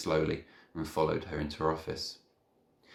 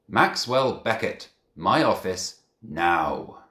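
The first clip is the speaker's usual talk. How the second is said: stern; 2 semitones higher, 8 dB louder, slower than usual. Acted. Headteacher summons boy in anger.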